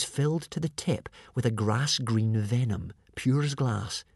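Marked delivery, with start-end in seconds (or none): none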